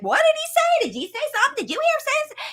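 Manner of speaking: high, amazed questions; mock astonished parent; startled parent. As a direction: sound like you can't believe he spoke. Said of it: "instead of like".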